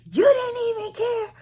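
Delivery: high, loud teary mimicry; mock crying mother. Imitating her mother returning in tears, high and wailing. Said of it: "So she comes back in".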